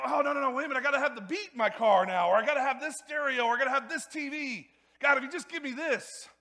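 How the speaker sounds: high, loud, whiny mock pleading. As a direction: play someone greedily piling up demands. Voicing someone demanding more from God.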